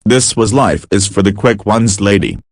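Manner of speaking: ordinary delivery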